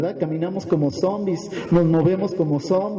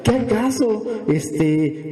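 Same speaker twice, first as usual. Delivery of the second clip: pitched-up acted challenge; mildly indignant retort; 4 semitones higher, slower than usual. Sufferers challenging the prophet, mildly indignant. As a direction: throw it back as a mock protest. Voices people objecting to the prophet.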